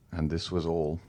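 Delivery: ordinary delivery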